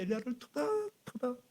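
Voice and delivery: 토닥토닥 lifted high and soft; tender high voice. Acted. God soothing exhausted Elijah, high and tender. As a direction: croon it tenderly.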